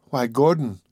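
usual speaking voice

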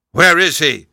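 high, loud shocked gasp of a question. Acted. Duke hears where his son is, loud shocked gasp. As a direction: blurt it out in shock. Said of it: "he gasped".

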